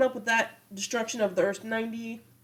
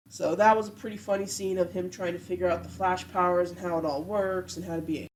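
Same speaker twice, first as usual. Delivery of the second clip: ordinary talk, nothing marked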